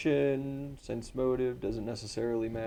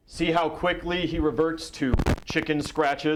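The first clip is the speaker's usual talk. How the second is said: taunt; 6 semitones higher, 4 dB louder than usual. Acted. Fighter taunts foe before the crowd.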